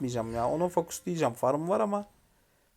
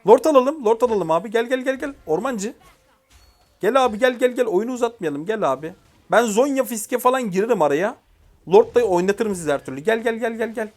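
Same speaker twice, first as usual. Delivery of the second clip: high, loud, insistent team call; urgent rallying; 7 semitones higher, 6 dB louder than usual. Real, not acted. Gamer urging his teammates to take Lord with him, excited. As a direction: push the team to come now.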